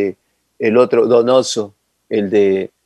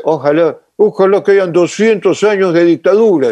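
higher-pitched, emphatic retold exclamation; Borges's fervent wish; 7 semitones higher than usual. Borges to Pinochet on being decorated, retold as a fervent exclamation.

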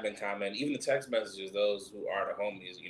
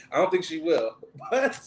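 laughing; 5 semitones higher, 6 dB louder than usual. Real, not acted. Laughing through line.

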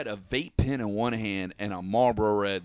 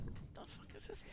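baffled disbelief; 11 semitones higher, 5 dB louder, faster than usual. Retold or imitated. Teller's own baffled reaction.

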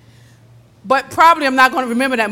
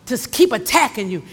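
normal talking voice

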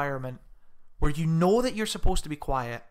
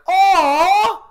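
huge high squealed "aww"; full-volume coo; 17 dB louder than usual. Retold or imitated. Demonstrating the girl's full-volume cinema coo. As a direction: let the coo rip at full blast. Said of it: "Full, like".

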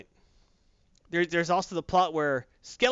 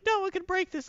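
much higher, prim defiant voice; high sorceress voice. Retold or imitated. Podcaster voicing the sorceress refusing Darkseid, mock high. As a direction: squeak it out like a haughty sorceress.

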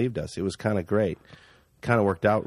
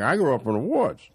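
thick imitation of a rural Canadian accent; backwoods Canadian accent; 3 semitones higher than usual. Actor retries the driver's woods line, backwoods accent. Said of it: "I'm trying to do his accent".

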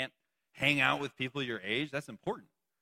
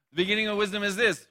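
faster and louder, punching this; louder quicker reread; 5 semitones higher, 6 dB louder, faster than usual. Shown as a demonstration. Rereading the verse to the congregation, faster and louder. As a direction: fire it off quick, landing hard on this. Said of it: "Oh, I don't know why it's up there like that".

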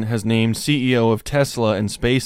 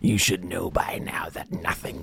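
mock villain whisper; 3 semitones higher, 3 dB quieter than usual.

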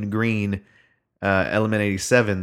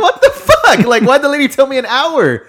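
high, loud exasperated laugh-yell; disbelieving yelp through laughter; laughing outrage; 7 dB louder than usual. Retold or imitated. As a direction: retell the outrage cracking up. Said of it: "And I was like".

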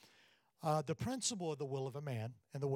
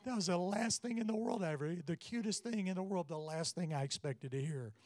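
ordinary talk

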